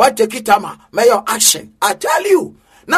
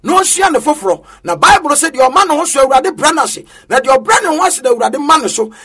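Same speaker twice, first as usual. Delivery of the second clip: normal talking voice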